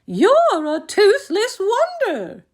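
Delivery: very high, slow teasing voice; singsong motherly mockery; amused mother. A narrator's reading. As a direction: tease a child in a high lilt. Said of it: "Froggy's mother laughed".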